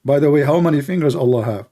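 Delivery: normal talking voice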